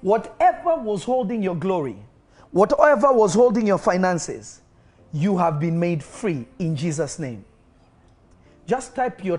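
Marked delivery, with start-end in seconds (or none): 0.0-7.4 high, loud declarations of healing; full-force preaching. Acted. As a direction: call out each illness and break it.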